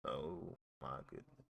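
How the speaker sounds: very soft, low disbelief; stunned murmur. Real, not acted. Streamer taking in the gunshots he heard, quiet and stunned.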